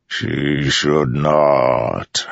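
very slow, immense godlike voice; Storm Father's voice. A narrator's reading. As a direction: let each word roll like distant thunder.

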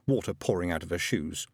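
usual speaking voice

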